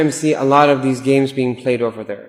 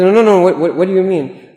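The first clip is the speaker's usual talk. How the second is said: higher and louder, eager to hear more; insistent eager demand; 5 semitones higher, 6 dB louder than usual. Retold or imitated. Friend begging the gossiper to tell all, in a higher, louder voice.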